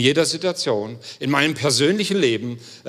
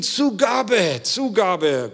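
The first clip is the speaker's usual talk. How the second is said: crowd calling for an encore; 5 semitones higher, much slower than usual. Retold or imitated. Crowd cheering the tightrope walker.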